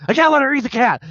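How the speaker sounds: high, frantic cat panic; yelling it out in a panic; panicky yelling. Retold or imitated. Retold panicky cat argument.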